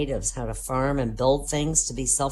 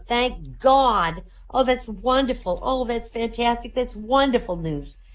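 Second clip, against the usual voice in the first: delighted relief; 7 semitones higher, slower than usual. Acted. Woman hears good news.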